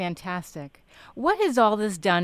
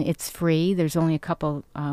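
ordinary delivery

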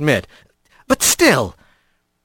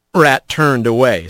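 ordinary delivery

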